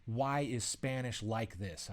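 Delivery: normal talking voice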